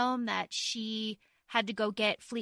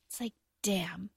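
whispered aside; 3 semitones lower, 6 dB quieter than usual. Real, not acted.

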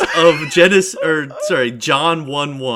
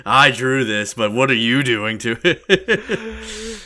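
mock offended artist; 5 semitones lower than usual. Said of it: "Araki's looking at you like".

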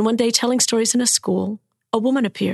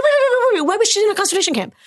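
much higher, louder rapid wait wait; alarmed disbelief; 12 semitones higher, 4 dB louder, much faster than usual. Retold or imitated. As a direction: stop him in shocked confusion. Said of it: "I said".

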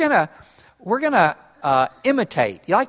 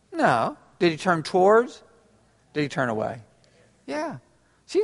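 ordinary talk, nothing marked

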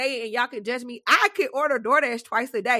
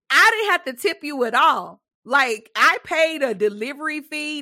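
normal talking voice

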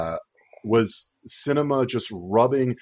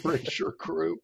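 whispered; 5 semitones higher, 7 dB quieter than usual. Real, not acted.

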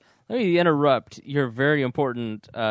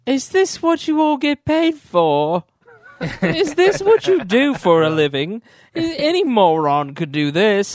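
high, loud, slow mock indignation at the table; mock outrage; 9 semitones higher, 7 dB louder, slower than usual. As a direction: scold them like a disgusted guest, hammed up. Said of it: "and he just looks at them and he goes".

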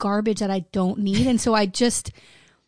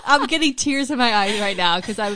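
laughing; 4 dB louder than usual. Real, not acted. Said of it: "half crying, half laughing".

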